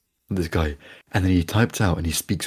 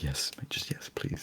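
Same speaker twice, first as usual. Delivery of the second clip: exasperated whisper; 3 semitones lower, 13 dB quieter, slower than usual. Retold or imitated. Exasperated with old postal clerk.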